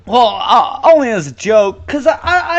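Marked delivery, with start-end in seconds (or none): none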